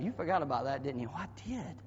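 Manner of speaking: ordinary delivery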